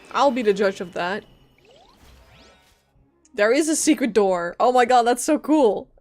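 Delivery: ordinary talk, nothing marked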